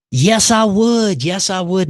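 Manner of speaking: eager acceptance. Retold or imitated. Lost man accepting an offer to pray, eager. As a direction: accept with heartfelt eagerness, stretching it out.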